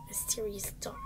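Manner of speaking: very soft, spooky whisper; mysterious whisper. Pretend. Building mystery about Dot.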